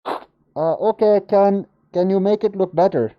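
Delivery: lightly put-on Indian accent; hesitant request in an imitated Indian accent. Launch official asking for better graphics, imitated Indian accent. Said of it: "Am I allowed to do an accent?".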